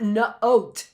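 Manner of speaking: "note" split into nuh and oat; choppy, unblended "note" as nuh-oat; not blending. Shown as a demonstration. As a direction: break "note" after the N. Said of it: "That's not blending".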